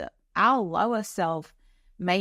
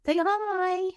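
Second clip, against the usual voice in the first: high sing-song pet voice; cat talk; 12 semitones higher than usual. Real, not acted. Talking to her cat Hendrix, high cooing pet voice. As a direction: coo at the cat in a much higher voice.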